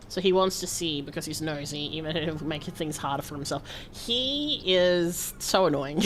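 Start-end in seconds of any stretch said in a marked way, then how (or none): 3.9-6.0 drawn-out fond annoyance; slow exasperated. Real, not acted. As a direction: stretch it out, amused. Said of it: "fond exasperation".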